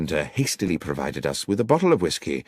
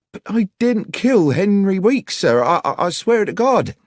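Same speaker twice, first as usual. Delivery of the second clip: high, loud plea of innocence; distressed protest; 6 dB louder than usual. Acted. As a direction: stammer and swear it in a much higher woman's voice. Accused woman protests her innocence.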